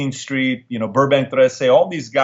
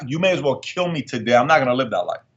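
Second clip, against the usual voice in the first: normal talking voice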